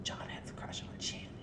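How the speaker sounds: hushed gossip whisper, unvoiced. Real, not acted. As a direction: lean in and whisper the secret. Gossip aside.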